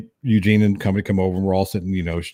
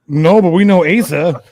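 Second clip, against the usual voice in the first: higher, louder, laughing through it; laughing reply; 7 semitones higher, 7 dB louder than usual. Retold or imitated. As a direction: answer while cracking up. Friends answer laughing.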